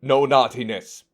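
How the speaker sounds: a playful telling-off, building; mock scolding. Playful warning opening the stream.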